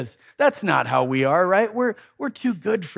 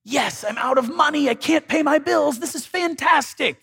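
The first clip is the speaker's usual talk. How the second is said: mock delight, much higher and louder; fake cheerful; 10 semitones higher, 4 dB louder than usual. Preacher mocking a fake-happy believer, hammed-up cheer. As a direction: shout out sarcastic joy over bad news. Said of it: "act like you're happy about them".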